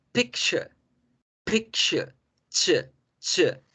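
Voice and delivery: PICTURE as "PIK-cha", high first syllable; sonido de estornudo. Shown as a demonstration. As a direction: sneeze the ch in PICTURE. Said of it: "como el sonido de un estornudo". (PICTURE pronunciado "pík-cha", che sorda)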